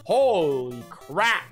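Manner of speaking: normal talking voice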